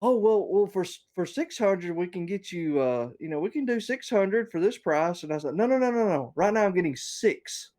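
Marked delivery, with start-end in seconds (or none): 0.0-5.2 higher, lightly voiced chirpy saleswoman; a hint of a bubbly sales rep. Voicing the sales rep's pitch. 5.6-7.7 high, insistent no no no; mock exasperated refusal; exasperated protest. Protesting to the rep.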